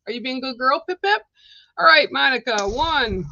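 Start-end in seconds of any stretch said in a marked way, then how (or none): 0.1-1.2 pet talk. Real, not acted. Asking her bird a question.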